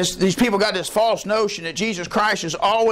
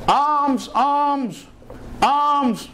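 beggar's call; 9 semitones higher, much slower than usual. Retold or imitated. Blind beggar begging by road.

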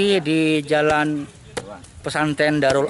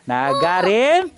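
ordinary delivery

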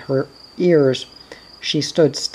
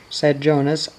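plain reading voice

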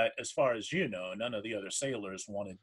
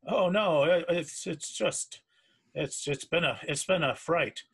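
high, loud stammering fright; shaken; 5 semitones higher, 6 dB louder than usual. Acted. Frightened sailor answering a concerned companion, shaken. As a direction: stumble over the words, rattled and jumpy. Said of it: "you seem rather shaken".

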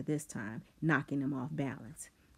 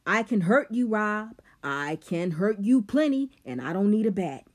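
loud, higher yelled threat; yelling; 6 semitones higher, 9 dB louder than usual. Acted. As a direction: yell the threat back at him. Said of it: "He yelled".

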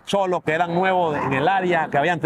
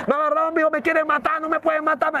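much higher cry, reenacted; retold yelling; 10 semitones higher than usual. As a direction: call out that they want to kill you. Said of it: "Y empecé a decir".

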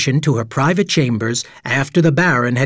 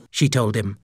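usual speaking voice

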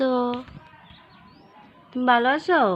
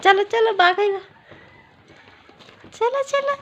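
coaxing sing-song; 7 semitones higher than usual. Real, not acted. Parent coaxing a toddler to come along, high sing-song call.

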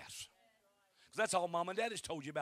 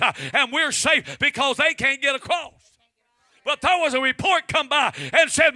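from loud to shouting, much higher; building preaching; 7 semitones higher, 19 dB louder than usual. Acted. Preacher building to a shouted climax about Jordan. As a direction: climb until the church shouts back.

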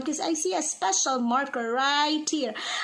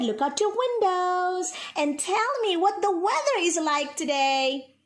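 usual speaking voice

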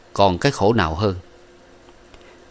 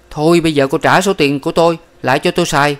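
higher, louder demand; 7 semitones higher, 4 dB louder than usual. A narrator's reading. Young man demands his money back, higher and louder. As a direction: ask for your money back.

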